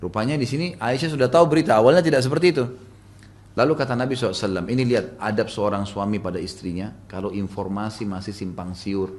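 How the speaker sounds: ordinary talk, nothing marked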